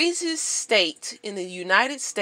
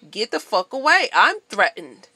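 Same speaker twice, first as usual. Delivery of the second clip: louder warning shout; warning off the woman at the pump, loud; retold yell; 7 dB louder than usual. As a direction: bark it at her to back off.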